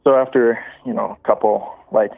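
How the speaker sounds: ordinary delivery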